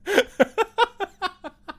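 high shrieking laugh. Real, not acted. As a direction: burst out laughing in shock. Reacts to the Paul Ryan reveal.

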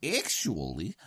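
ordinary talk, nothing marked

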